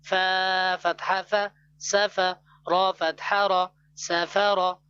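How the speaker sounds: ordinary talk, nothing marked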